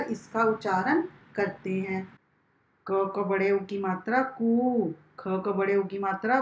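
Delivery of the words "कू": कु drawn out as a long "koo"; long vowel demo. Shown as a demonstration. Teacher joining क to long ऊ for pupils, drawn-out कु. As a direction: hold the vowel of कु for extra time. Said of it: "लंबा तथा काफ़ी समय तक पढ़ा जाता है".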